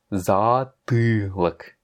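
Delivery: "Затылок" stretched out slowly; syllable by syllable; very slow word. Shown as a demonstration. Teacher modelling a Russian word very slowly.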